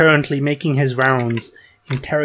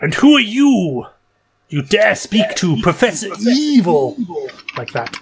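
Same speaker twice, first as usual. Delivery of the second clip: cheesy villain voice; 6 semitones higher, 4 dB louder than usual. Acted. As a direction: sneer it like a cheesy supervillain. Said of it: "His voice is pretty cheesy".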